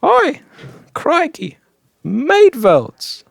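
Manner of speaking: high-pitched mock Aussie exclamations; bad Australian accent. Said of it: "I can't do an Australian accent at all".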